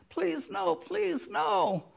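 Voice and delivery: a touch of begging, one please jumping high; pleading. Retold or imitated. Fellow servant begging the forgiven man, mild pleading. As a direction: beg for mercy, lightly acted. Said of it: "The guy's pleading".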